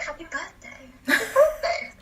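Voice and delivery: high, soft British imitation; lilting put-on British greeting; British accent. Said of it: "That was the best British accent".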